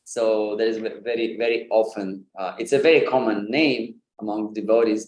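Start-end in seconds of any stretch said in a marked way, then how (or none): none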